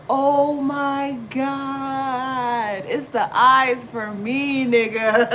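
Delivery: loud, high, very slow gush; awestruck gush. Retold or imitated. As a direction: draw it out in awe. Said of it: "I was like".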